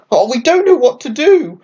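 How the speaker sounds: a worried little bird twittering; high anxious chirpy plea; tweeted. A narrator's reading. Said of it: "tweeted Jilly".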